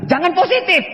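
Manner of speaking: a hint of a stern wife; wife warning. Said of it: "istri saya bilang".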